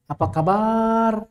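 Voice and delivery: "Apa kabar?" said slowly, every syllable short and even; slow demo. Shown as a demonstration. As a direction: spell out "Apa kabar?" slowly to show no long vowels. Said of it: "Ada panjangnya nggak di situ?".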